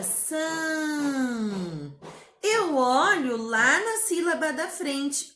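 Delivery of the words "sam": "sam" held long on one note, gently falling; stretched syllable. Shown as a demonstration. Teacher isolating the long syllable for children, "sam" drawn out. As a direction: hold "sam" like a long hum.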